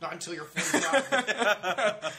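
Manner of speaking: slow, high, loud joke through laughter; laughing mock parent. Mother answering her kid in line, laughing mock refusal. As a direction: play a parent's refusal while cracking up.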